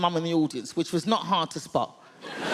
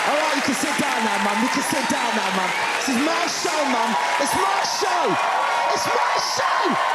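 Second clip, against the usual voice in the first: high, loud calling out; 9 semitones higher, 4 dB louder than usual. Real, not acted. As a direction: shout across the room to mum. Calling out to mum in audience.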